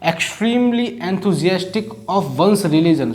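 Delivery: definition read slowly; teacher dictating the meaning; slow dictation. Shown as a demonstration. Reading the English definition.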